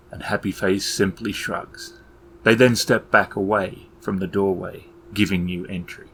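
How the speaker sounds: usual speaking voice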